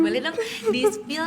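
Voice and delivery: giggling through a teasing request, drawn out; real laughter breaking into the words; laughing. Real, not acted. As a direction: coax her playfully while laughing. Teasing host coaxing a secret.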